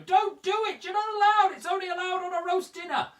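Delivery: hammed-up viewers at home begging him not to; outraged viewers. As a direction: squawk like scandalised traditionalists. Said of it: "some of you at home going".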